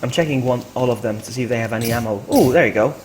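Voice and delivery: usual speaking voice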